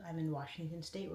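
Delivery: normal talking voice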